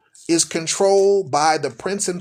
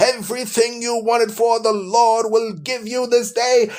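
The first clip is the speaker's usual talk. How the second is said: mock prosperity preacher; 5 semitones higher than usual. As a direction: belt it out like a hyped-up pulpit preacher. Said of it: "that's how they talk, y'all".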